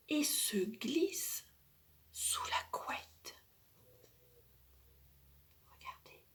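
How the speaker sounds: sneak the words out in a whisper. A narrator's reading. Narrator hushes, child sneaks.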